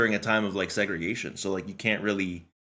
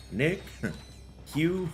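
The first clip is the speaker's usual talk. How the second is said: giggly mock greetings; 7 semitones higher, 6 dB quieter, slower than usual. Laughing cartoon roll call.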